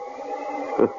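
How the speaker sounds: short, breathy, scornful chuckle; mocking laugh. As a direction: laugh at her under your breath. Killer mocks her.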